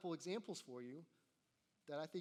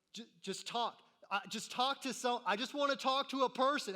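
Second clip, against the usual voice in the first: much higher and louder, fed up; frustrated yell at the phone; 8 semitones higher, 11 dB louder than usual. Retold or imitated. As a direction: shout at the automated line to get a human. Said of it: "Have you ever know, yelled on the phone?".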